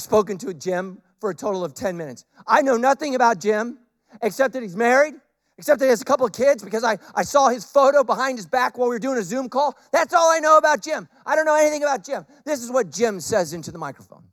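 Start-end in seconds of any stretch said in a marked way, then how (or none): none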